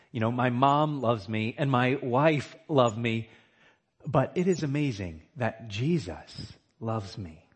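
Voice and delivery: usual speaking voice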